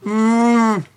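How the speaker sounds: long, blissful food moan; drawn-out savoring hum; moan of pleasure. Real, not acted. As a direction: moan helplessly over the cookie. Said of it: "gonna stop moaning at some point".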